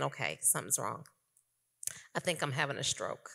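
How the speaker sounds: low, quiet, frightened words to her husband; hushed alarm. Retold or imitated. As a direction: say it low and scared, half under your breath. Telling husband she's having stroke.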